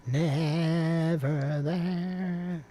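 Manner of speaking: drawn-out call. Pretend. Co-host playing with words at the start, drawn-out call. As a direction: stretch every syllable right out. Same words stretched out playfully.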